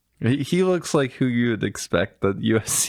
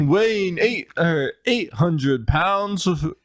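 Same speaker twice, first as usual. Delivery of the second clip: ring announcer; 7 semitones higher, slower than usual. Retold or imitated. Joining the announcer bit.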